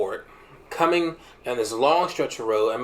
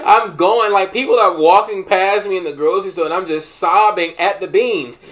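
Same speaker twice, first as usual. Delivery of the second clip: louder, higher excited telling; heated retelling; 6 semitones higher, 7 dB louder than usual. Retold or imitated. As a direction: build it up loud and worked up. Retelling sobbing in grocery store.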